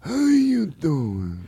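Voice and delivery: slow mobster drawl; slow Godfather mob boss. Acted. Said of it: "Godfather impersonations".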